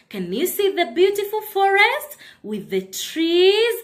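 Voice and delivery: usual speaking voice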